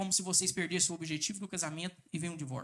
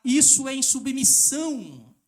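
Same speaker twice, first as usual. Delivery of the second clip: loud, slow pulpit denunciation; emphatic condemnation; 5 semitones higher, 9 dB louder, slower than usual. Real, not acted. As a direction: hammer each word. Preacher stresses sin.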